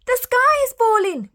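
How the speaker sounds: very high, loud single cry; panicked chick. A narrator's reading. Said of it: "panted Chicken Licken".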